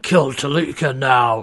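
slow mock growl, drawn out. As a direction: growl it slowly like a feral creature. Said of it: "like, growling".

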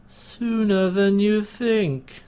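slow, high, drawn-out menace; sinister villain voice. Retold or imitated. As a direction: purr it like a smug villain. Mocking villain line.

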